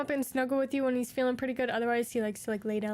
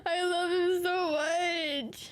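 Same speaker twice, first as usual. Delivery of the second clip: drawn-out gushing; 4 semitones higher, much slower than usual. Real, not acted.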